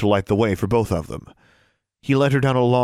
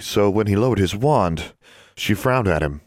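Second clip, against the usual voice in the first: usual speaking voice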